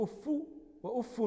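أف as "uffu", then "uffun"; damma, then with nunation. Shown as a demonstration. Teacher listing dialect forms of أف, "uffu" then "uffun", each set apart. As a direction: give أف a final u, then add n. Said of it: "الضم والتنوين".

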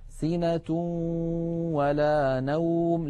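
very slow tajweed chant, long hummed glide from سِنَةٌ into ولا; measured Quranic example with held ghunnah; partial idgham recitation. Shown as a demonstration. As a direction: stretch the tanween of سِنَةٌ nasally into the و. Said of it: "تبقى فيه الغنة".

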